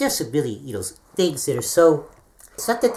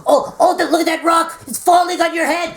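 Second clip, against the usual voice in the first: high, loud fake warning; mock alarm; 13 semitones higher, 5 dB louder than usual. [name] pranking him back with a fake falling rock, high and loud. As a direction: fake panic to fool him. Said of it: "a taste of your own medicine".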